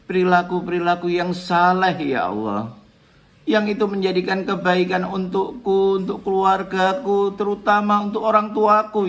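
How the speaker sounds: heartfelt petition for the parents; pleading prayer. Shown as a demonstration. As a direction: beg God for guidance, rising fervour. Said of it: "kita bisa".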